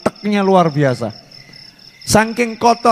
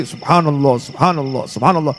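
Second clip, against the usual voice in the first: savoring dhikr; 5 semitones lower than usual. Acted. Savouring sweet dhikr.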